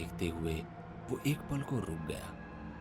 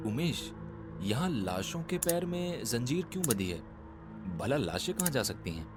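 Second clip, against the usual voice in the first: higher-pitched, startled questioning; 6 semitones higher than usual. A narrator's reading. Vikram to Umesh on seeing chained corpses, startled. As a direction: ask it in alarmed surprise, pitch jumping up. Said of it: "चौंकते हुए उमेश से कहा".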